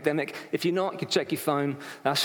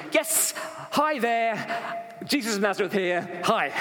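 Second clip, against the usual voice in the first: higher-pitched, chirpy mock hello; dweeby IT consultant saying hi; dweeby casual greeter; 5 semitones higher than usual. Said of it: "it's not the same thing as going".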